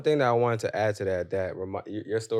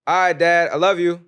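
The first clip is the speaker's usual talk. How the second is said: raised call across the house; calling out to dad; 9 semitones higher, 7 dB louder than usual. Retold or imitated. Calling out to his dad at the door, raised voice. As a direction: call it out so he hears. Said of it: "So then I yelled it".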